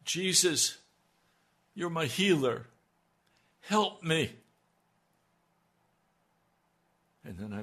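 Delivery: fervent praise, rising and falling; testimony. Acted. Preacher testifying to listeners, fervent and earnest. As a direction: proclaim it like a testimony in church. Retelling her prayer and testimony.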